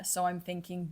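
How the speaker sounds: normal talking voice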